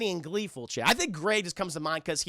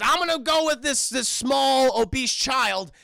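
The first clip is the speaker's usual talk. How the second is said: put-on higher, louder girl character quote; Mabel; 7 semitones higher, 6 dB louder than usual. Mabel declaring her plan, high-pitched and loud. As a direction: announce the choice loud and high. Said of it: "Mabel says".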